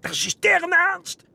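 high, loud, slow retort; indignant outburst. Acted. Old man retorts loudly to the doubting officer. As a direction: fling the words back in outrage.

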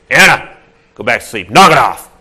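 put-on loud booming order to the child; fast, loud fatherly scold; stern dad voice. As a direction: bark it to stop her fussing. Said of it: "She doesn't need your dad voice".

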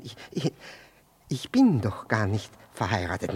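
ordinary talk, nothing marked

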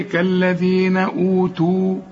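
slow, أوتوا held on a long final oo; stopping on waw. Shown as a demonstration. Teacher shows stopping, long final waw in أوتوا. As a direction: stop on أوتوا with the full waw. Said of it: "إذا وقفت تقول إيه".

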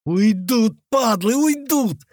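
tense through-the-teeth exclamation; hissed through teeth. A narrator's reading. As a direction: seethe it through clenched teeth, drawn-out ending. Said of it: "сквозь зубы зашипел Нема".

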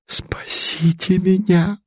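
breathless, pleading cry for rescue; desperate plea. A narrator's reading. The princess throws herself at the banker, pleading. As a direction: beg to be saved, nearly whispering. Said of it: "Вдруг ринулась её светлость".

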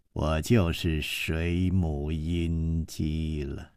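low, slow, mocking disbelief; scornful woman mocking his name; sneering. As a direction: sneer at his claim, unimpressed. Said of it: "这个女子就冷笑起来".

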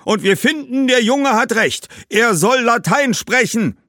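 high, loud firm verdict; Roman ghosts insisting. A narrator's reading. Roman ghosts demand Latin. (hoch und laut bestimmende Römergeister)

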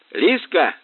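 high, loud cry; roaring name. A narrator's reading. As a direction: roar her name. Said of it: "прямо-таки взревел тот". (взревел)

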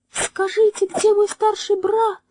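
very high, sweet bird voice; chirping little bird. A narrator's reading. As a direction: chirp it like a tiny songbird. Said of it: "дивным голосом прощебетала маленькая птичка".

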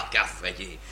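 low breathy chuckle; sinister laugh. Acted. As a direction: laugh darkly under the breath. Rire après la cravache. (petit rire sombre et soufflé)

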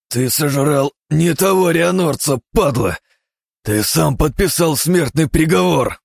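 high, loud, slow threatening shout; furious shout. Acted. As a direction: bellow a death sentence. Threatening the monster. (яростный крик)

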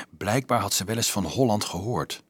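usual speaking voice

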